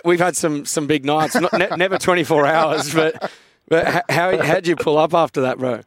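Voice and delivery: ordinary talk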